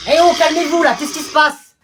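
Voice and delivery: loud, high call to order; shouted reprimand. Acted. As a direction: cut through the uproar.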